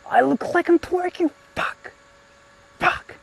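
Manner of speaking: very high squeaky cry of embarrassment; embarrassed squeal. Real, not acted. Streamer sees own pose on camera, high embarrassed squeal. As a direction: pitch it way up in mortified disbelief.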